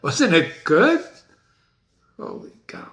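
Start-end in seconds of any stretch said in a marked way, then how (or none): 2.2-2.9 breathy whispered amazement; a real hushed holy cow; awed whisper. Real, not acted. Awed after the ending.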